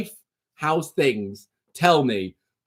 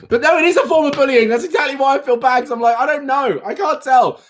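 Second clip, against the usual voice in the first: laughing, fast; 9 semitones higher, 4 dB louder than usual. Real, not acted. Laughing over feeling bad.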